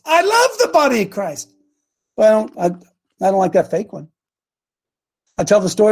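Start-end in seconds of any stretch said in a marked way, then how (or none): 0.0-1.5 fervent. Real, not acted. Repeated, higher and different timbre.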